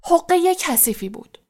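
indignant, high-pitched scolding; angry shout. A narrator's reading.

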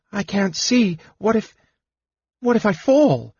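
high, scared woman's voice; frightened protest. A narrator's reading. Woman afraid of falling in the dark, frightened protest. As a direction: falter anxiously, pitched well up. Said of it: "her voice shaking".

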